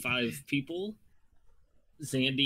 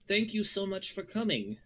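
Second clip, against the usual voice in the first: higher chirpy shaky voice; chirpy shaky gnome; 8 semitones higher than usual. Acted. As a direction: chirp it nervously. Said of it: "Yelsa's voice chirps shakily".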